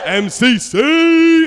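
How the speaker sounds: long loud call, drawn out; loud stretched cheer; shout-out. Real, not acted. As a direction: yell it out to the crowd, slowly. Shouting out his college.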